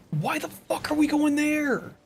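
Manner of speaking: high, drawn-out, exasperated why; exasperated outburst. Real, not acted. Streamer yelling at his teammates' route, exasperated and high. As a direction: stretch the question out in real disbelief. Repeats question, much higher, slower.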